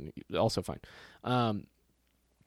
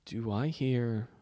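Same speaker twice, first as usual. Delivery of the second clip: retold puzzlement; slower than usual. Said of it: "I literally was like".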